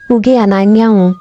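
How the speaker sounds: ordinary delivery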